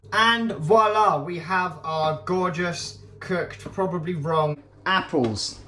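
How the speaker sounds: slow, drawn-out showman's voila; a hammed-up ta-da over background music; mock grand reveal. Real, not acted. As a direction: present it like a magician.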